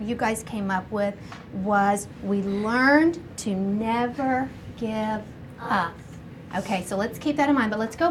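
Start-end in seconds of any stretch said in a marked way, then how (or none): 2.2-5.9 measured, word-by-word reading; slow read-out. Shown as a demonstration. Teacher reads the class's sentence back, slow and deliberate. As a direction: spell the sentence out slowly for listeners.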